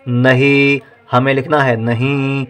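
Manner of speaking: ordinary delivery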